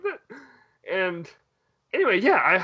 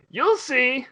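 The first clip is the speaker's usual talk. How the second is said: coy creature; 6 semitones higher, 3 dB louder than usual. Retold or imitated. Creature teases kid.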